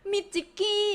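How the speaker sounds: high, squeaky cutesy voice; cute nickname voice. Pretend. Teasing the donor about a cute nickname, in a high, cutesy voice. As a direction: chirp the name like a cartoon mascot.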